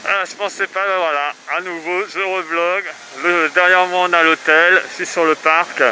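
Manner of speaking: muffled behind a mask; talking through a face mask. Real, not acted. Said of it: "c'est pas super cool avec le masque". (voix étouffée par le masque)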